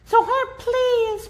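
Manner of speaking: very high, slow plea; high small pleading voice; tiny pleading mayor. A narrator's reading. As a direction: beg like a little mayor. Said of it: "pleaded the voice".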